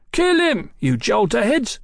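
very high, furious shout. A narrator's reading. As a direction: ruffian yelling at his useless mates. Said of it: "shouted the muffled man".

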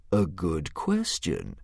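usual speaking voice

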